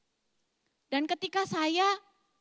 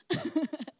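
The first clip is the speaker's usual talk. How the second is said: short laugh; 3 dB quieter than usual. Real, not acted. Preacher laughs at own accent.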